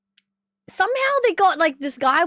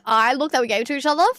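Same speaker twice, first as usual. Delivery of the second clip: ordinary talk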